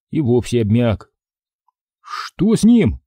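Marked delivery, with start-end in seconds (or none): 2.0-3.0 frightened mumble. Acted. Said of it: "испуганно пробормотал Сашка".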